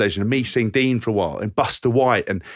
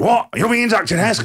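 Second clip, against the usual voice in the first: high, startled question; a deaf old man caught off guard; surprised old man; 11 semitones higher, 4 dB louder than usual. Retold or imitated. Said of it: "deaf old Jeff surprised".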